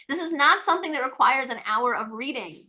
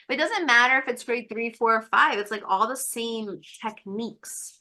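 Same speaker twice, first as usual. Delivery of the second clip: ordinary delivery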